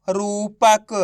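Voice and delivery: रूपक in one breath, syllables run together; blended word. Shown as a demonstration. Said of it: "तो बताइए मिलके क्या बनेगा?". (रूपक को मिलाकर एक शब्द में)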